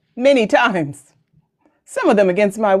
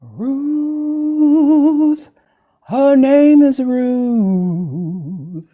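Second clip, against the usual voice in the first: drawn-out announcement; 3 semitones higher, much slower than usual. Pretend. Announcing the guest's name.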